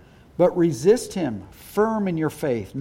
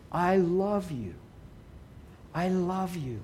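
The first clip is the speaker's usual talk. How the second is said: tender prayer; 8 dB quieter, slower than usual. Shown as a demonstration. Tender prayer voiced to God.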